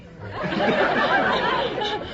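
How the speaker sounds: high breathy giggle. Real, not acted. Giggling nervously about the next quiz question. Laugh after closing eye.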